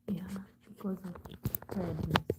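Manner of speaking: soft slow whisper. Real, not acted. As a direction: whisper it slowly. Unvoiced whisper.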